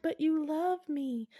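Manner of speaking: high, drawn-out coaxing; mock lovelorn plea. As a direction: plead slowly, playing the spurned lover. Undead lover pleading, acted.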